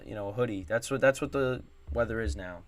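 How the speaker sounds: ordinary talk, nothing marked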